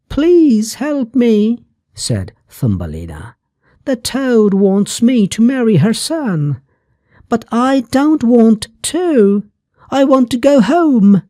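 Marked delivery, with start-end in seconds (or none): 0.1-1.6 put-on high, slow little-girl plea; tiny girl pleading. A narrator's reading. Tiny girl begs butterfly. 3.9-11.2 put-on high little-girl voice, pleading; a tiny girl asking to go home, pitched well up. A narrator's reading. Thumbelina voiced high throughout.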